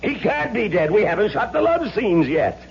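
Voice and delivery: rising, frantic pleading; panicked. Acted. Director begging the dead actor, high and panicked. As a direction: voice climbing in disbelief.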